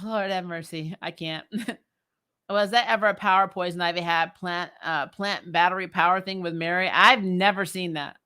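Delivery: ordinary talk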